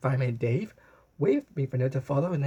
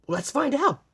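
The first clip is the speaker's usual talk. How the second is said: higher, eager let's find out; with excitement; 12 semitones higher, 3 dB louder, slower than usual. A narrator's reading. Blue excitedly answering Sprinkles, higher pitched. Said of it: "answered Blue with excitement".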